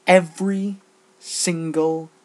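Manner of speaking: Every single punched loud; stress on every single. Real, not acted. Vlogger complaining about bronies changing music. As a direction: hit Every single hard. Emphasizing repeated offense.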